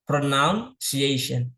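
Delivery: pronunciation stretched out slowly syllable by syllable; drawn-out pronunciation for students to repeat; slow model word. Shown as a demonstration. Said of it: "ikuti kalimat yang saya ucapkan".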